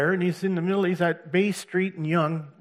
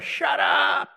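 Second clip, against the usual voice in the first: much higher, shouted; mock angry yell; 12 semitones higher, 5 dB louder than usual. Drivers yelling at the preacher, hostile shout. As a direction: bark it at him from a car. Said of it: "People were yelling at me in cars".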